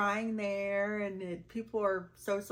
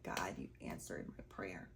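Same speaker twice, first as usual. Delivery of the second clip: lower, much softer hushed whisper; whispered prayer; 12 semitones lower, 8 dB quieter than usual. Retold or imitated. Grateful prayer to God after the comments, a low hushed whisper. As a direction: barely breathe the thanks to God. Quoting her own thankful prayer.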